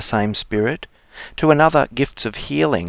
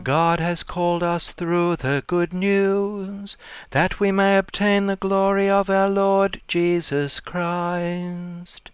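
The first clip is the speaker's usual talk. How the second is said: cathedral echo; 8 semitones higher, 3 dB quieter, slower than usual. Acted. Acclamation verse intoned.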